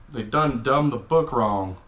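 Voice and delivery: normal talking voice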